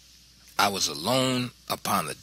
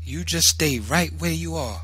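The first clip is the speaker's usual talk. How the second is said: higher-pitched, controlled menace; understated deadly warning from a mother; 7 semitones higher than usual. A narrator's reading. Mother halting son on the steps, deadly tone. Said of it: "she said in a deadly tone".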